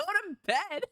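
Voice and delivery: high-pitched stern mom order. Shown as a demonstration. Stern mom telling people to go to bed, pitched high. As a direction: send them off like a stern mom. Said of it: "stern mom kind when I tell people".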